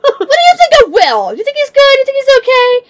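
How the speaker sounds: high, loud, sweetly nosy; fake innocent. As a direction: ask all innocent and high. Fake casual question to manager.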